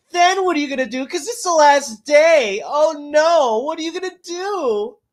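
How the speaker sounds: high, loud sarcastic taunting; sarcastic mockery. Taunting voters who put off voting, high sarcastic sing-song. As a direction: needle them with mock concern.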